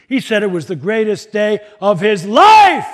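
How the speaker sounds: exultant yell building to a long, high LIFE; triumphant shout. Retold or imitated. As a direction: crow it loudly like a winner. Boy gloats over winning.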